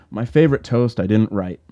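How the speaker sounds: ordinary delivery, nothing marked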